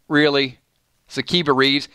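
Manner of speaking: normal talking voice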